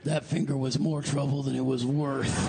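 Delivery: low, dry camp rasp; deadpan shrug about losing it. Retold or imitated.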